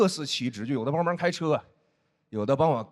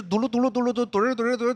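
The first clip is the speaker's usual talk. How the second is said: quick playful jiggle noise, a touch higher; jiggling flesh sound; 5 semitones higher, faster than usual. Pretend. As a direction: bounce out the syllables fast like wobbling fat.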